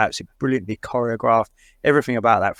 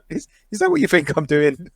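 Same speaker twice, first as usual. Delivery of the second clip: a hint of an offended Deadpool, higher; lightly mock indignant; quoting Deadpool; 5 semitones higher than usual. A podcaster retelling a movie line, mild mock indignation.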